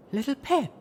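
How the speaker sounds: high shocked cry of the name; gasping. A narrator's reading. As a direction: gasp her name in shock. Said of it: "Velvet Remedy gasped".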